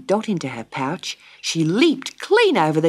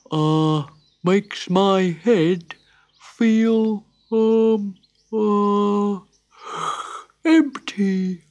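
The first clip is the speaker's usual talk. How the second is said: slow, halting, yawning drawl; drowsy koala; 6 semitones higher, 3 dB louder, much slower than usual. A narrator's reading. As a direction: drift off between the words. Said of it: "he fell asleep".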